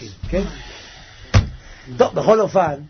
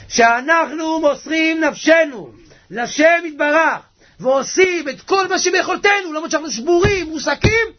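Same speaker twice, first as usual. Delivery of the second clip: impassioned, raised sermon voice; fervent preaching; 10 semitones higher, 4 dB louder than usual. Real, not acted. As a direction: preach it with full conviction. Preaches at full force.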